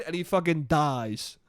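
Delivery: ordinary delivery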